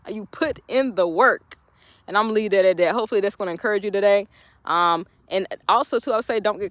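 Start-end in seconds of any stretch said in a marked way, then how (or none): 0.1-1.6 words spaced out slowly; slow, deliberate repeat. Acted. To hardworking viewers, a slow emphatic repeat. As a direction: let it land slowly.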